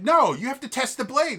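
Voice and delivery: high, loud retold outburst; freaking out. Contestant freaking out at the judges, loud. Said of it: "This guy freaks out".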